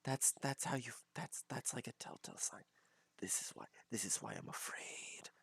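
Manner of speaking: hushed, breathy mock-fearful whisper. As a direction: whisper it like you're scared to be heard. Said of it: "You see my fear".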